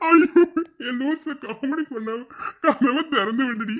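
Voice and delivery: very high, squealing laughing words; helpless laughter. Acted. Husband begging wife to stop the comedy, laughing helplessly. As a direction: talk slowly through uncontrollable laughter.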